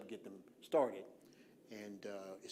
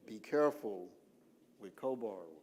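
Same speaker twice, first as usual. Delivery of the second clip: repeated warning, spaced out for effect; deliberately slow. Real, not acted. Presenter repeating a co-borrower warning, deliberately slow. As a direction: break it in two, slowly and firmly. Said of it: "I'm slowing down on purpose".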